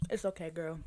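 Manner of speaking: soft reassuring whisper; gentle whisper; whispered comfort. Real, not acted. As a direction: hush and reassure her. Comforting friend.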